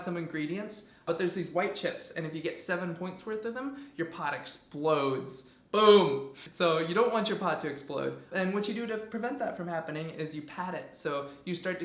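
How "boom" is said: sudden loud explosion sound; loud boom. Pretend. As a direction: blurt it out like a blast. Mimicking the pot exploding.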